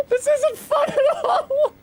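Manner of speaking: high, slow fake-sob whine; mock crying. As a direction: wail it like a fake cry.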